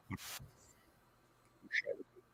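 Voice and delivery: breathy stage whisper. Pretend. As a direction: drop to a whisper for the second take. Repeats same words unvoiced.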